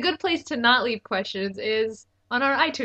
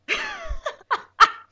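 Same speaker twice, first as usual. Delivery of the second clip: high laugh. Real, not acted. Laughs at own bad joke.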